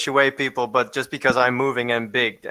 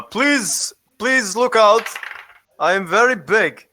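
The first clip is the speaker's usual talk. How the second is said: intimidating; 7 semitones higher than usual. Pretend. Intimidating a crowd in line.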